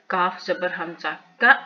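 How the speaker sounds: का stopped short with a glottal catch; hamza jerk. Shown as a demonstration. Teacher spelling for learners, का ending in a jerk. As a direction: cut the vowel of का abruptly. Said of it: "झटका देंगे".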